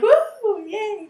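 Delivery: high mock cheer, then dropping off. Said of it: "And I was like".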